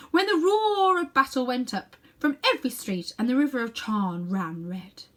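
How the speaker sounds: a proud queen lingering over war and slaves; grand queen recalling. Acted. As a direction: relish each sound of the old city. Queen recalls the noise of Charn.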